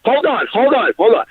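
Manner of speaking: lightly voiced whiny player cutting in; mocking whiny protest. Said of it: "All I hear is you saying".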